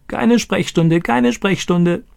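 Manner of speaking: high, squawky parrot voice; crowing parrot. Acted. Owner imitates his parrot's new sentence, crowing high. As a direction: crow it like a talking parrot. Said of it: "kräht er".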